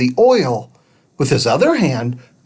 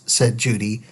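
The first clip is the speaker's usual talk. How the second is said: usual speaking voice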